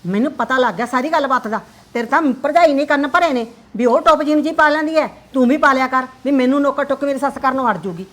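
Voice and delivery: loud, accusing; angry accusation. Acted. Mother accusing her daughter of being stirred up by her sister-in-law. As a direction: throw the blame at her.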